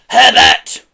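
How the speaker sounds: harsh, high, very loud screech. A narrator's reading. Pelly calling her husband to act, screeching. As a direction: screech the name. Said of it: "screeched Pelly".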